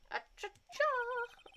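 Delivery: high, drawn-out startled cry; startled yelp. Acted. Old lawyer startled at Maya's name, high yelp. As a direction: yelp it out in shock, slowly.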